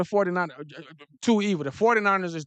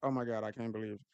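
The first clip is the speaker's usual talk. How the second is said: stunned disbelief; 7 semitones lower, 10 dB quieter, faster than usual. Retold or imitated. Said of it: "you like".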